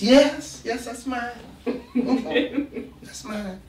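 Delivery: lightly voiced calm old woman; elderly driver owning the gun; sweet old lady. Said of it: "old woman answering".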